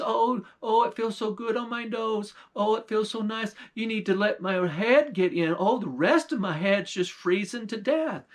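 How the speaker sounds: put-on high, wheedling old witch; pleading witch voice. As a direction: coax your way in, sweetly.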